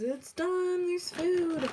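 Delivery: high, sing-song call of dinner being ready; mock dad calling. Dad calls the kids down, lightly imitated high call. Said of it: "tell your kids".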